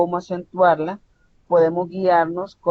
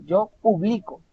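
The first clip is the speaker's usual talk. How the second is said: "yo publico" pitched higher, weight on BLI; stress on middle syllable; 4 semitones higher than usual. Shown as a demonstration. Teacher giving the next example, middle syllable of "publico" stressed. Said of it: "está en medio".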